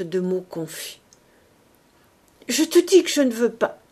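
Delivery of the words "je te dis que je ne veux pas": louder, choked pleading refusal; desperate lover crying out against her dying; sobbed plea. Acted. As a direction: refuse her death through sobs. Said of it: "mais sanglotées".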